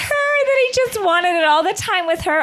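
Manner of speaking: high, laughing disbelief. Real, not acted. Co-host grasping the mix-up, laughing. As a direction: laugh out the realization.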